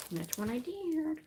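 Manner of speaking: goofy squeaky repeat; silly put-on voice. Repeating her own line to viewers, in a soft, slow put-on voice.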